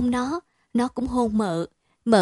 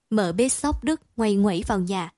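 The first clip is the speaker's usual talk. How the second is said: ordinary delivery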